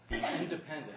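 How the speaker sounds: independent said high and loud with a laugh; emphasis on independent. Real, not acted. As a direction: lean hard on independent. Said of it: "I want to emphasize the word independent".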